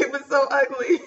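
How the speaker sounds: laughing through the words, then a high breathy laugh. Real, not acted. Telling viewers her cry was ugly, laughing. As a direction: giggle at yourself.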